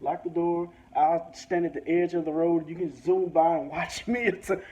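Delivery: ordinary talk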